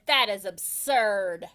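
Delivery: stern neighbour dismissing it; disdainful. A narrator's reading. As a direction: say it with scorn. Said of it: "Eugenia said".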